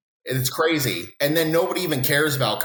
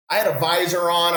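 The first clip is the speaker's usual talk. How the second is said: ordinary delivery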